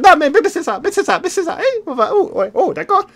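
high, loud squeaky voice breaking into laughter; high character voice, laughing. Acted. Streamer in character cracking up, high and loud. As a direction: push the silly voice high and loud.

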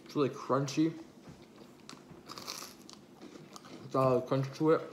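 talking between bites; chewing while talking. Real, not acted. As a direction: mouth full of food. Seen 0: Takes repeated bites and chews pizza throughout the talking.

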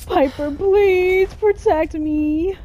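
high, loud, slow begging; terrified plea. Real, not acted. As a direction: beg your companion to save you. Begging companion during fight.

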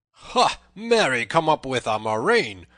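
high, loud angry outburst; fuming cry. A narrator's reading. Tanner scorns Little John's threat, fuming. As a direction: cry out in a fume. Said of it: "talked himself into a fume".

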